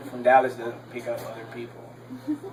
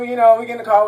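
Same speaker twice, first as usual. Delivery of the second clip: ordinary talk, nothing marked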